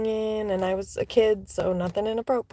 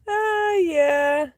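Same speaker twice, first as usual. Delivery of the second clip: high laugh trailing into yeah; 11 semitones higher, 4 dB louder than usual. Real, not acted. Laughing at memory.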